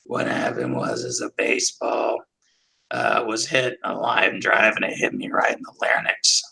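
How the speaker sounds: rasping near-whisper, low and slow; damaged voice. Retold or imitated. Dean explaining his injury in his damaged voice. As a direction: push the words through a crushed larynx. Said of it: "And so he just goes ... talk like like this".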